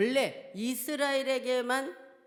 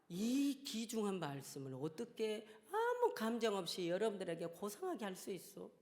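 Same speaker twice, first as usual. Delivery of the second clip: ordinary delivery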